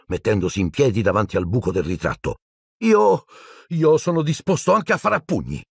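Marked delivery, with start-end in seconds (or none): 2.8-5.6 put-on high, desperate boyish challenge; defiant boy. A narrator's reading. Neville desperately challenges his friends. (ragazzino disperato, sfida acuta)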